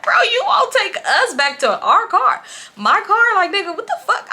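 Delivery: high, loud incredulous retort; indignant. Retold or imitated. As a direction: retell it in outraged disbelief. Narrator's outraged retort in story.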